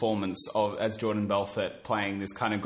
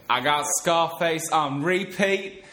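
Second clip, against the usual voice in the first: film character quote; 5 semitones higher, 4 dB louder, slower than usual. Acted.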